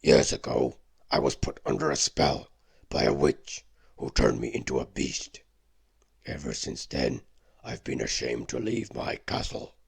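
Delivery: beast's sad tale. Acted. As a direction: tell the curse as a shamed beast. Beast tells his backstory.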